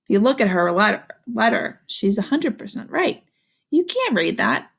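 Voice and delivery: usual speaking voice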